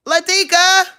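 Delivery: high, loud call to the dog. Real, not acted. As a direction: holler the name through the house. Calling out to dog.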